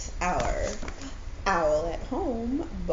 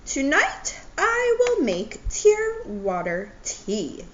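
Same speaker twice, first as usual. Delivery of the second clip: Owl announcing, higher and slower; 9 semitones higher, 5 dB louder than usual. Acted. Owl tells himself, announcing his plan. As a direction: lift the voice to play Owl, deliberate. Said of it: "he said".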